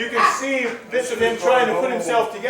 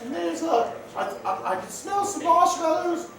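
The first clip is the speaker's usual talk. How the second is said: high, rambling spirit voice; a giddy little ghost chattering; 7 semitones higher than usual. Acted. Spirit rambles about cave.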